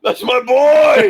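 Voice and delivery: high, drawn-out mock scream. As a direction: scream it like an overwrought movie father. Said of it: "Cecil starts screaming in his face".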